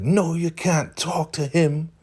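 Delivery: very high, drawn-out imitation; slow, high-pitched acted objection; mock indignant refusal. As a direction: squeal it slowly like a jealous rival. Acts jealous refusal in quarrel.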